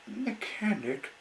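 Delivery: very high startled question; alarmed by the word; surprised exclaim. Acted. The engine exclaims to Mister Woody, very high.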